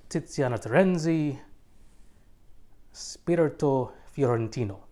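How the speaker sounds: loud, put-on Italian accent; hammed-up Italian, each name given its own moment; fake Italian accent. Reviewer announcing an Italian fragrance name, fake Italian accent. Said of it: "put on your best fake Italian accent".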